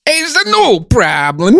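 cartoonish high Italian gibberish; sing-song mock Italian, drawn out and very high; Italian accent. Shown as a demonstration. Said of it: "you wanna go to Italy?".

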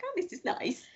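mock polite delight. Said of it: "We were like".

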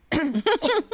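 sudden choking cough; coughing fit. Real, not acted. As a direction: cough hard, caught on saliva. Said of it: "Sorry, I'm choking".

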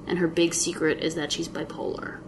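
ordinary delivery

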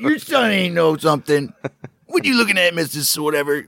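quoting the mom. Retold or imitated. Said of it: "or what is it?".

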